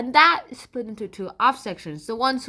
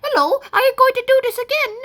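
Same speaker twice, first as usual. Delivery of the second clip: put-on very high, loud, quick British accent; 12 semitones higher, 5 dB louder, faster than usual. Pilgrim trying to regain lost Britishness, British accent. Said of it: "I really want to talk like this again".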